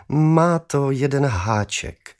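slow, damping the excitement; father cooling down the boy's joy; drawn out, sobering; calming. A narrator's reading. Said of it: "krotil mé nadšení tatínek".

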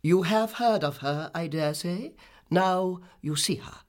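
high, slow, haughty delivery; lofty, measured grande dame; imperious visitor. Acted. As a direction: present yourself with grand self-importance.

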